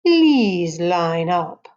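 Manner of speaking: put-on very drawn-out, eerie Romanian accent. A narrator's reading. Missus Jeepers lines up the class, slow Romanian accent. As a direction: stretch each word in a Dracula-style Romanian voice. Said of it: "said in her strange Romanian accent".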